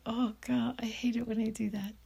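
fast whispered self-reproach; whispered aside. Real, not acted. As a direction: whisper it quickly to yourself. Annoyed aside to herself.

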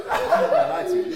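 laughing. Real, not acted. Laughing at own confession.

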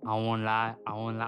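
usual speaking voice

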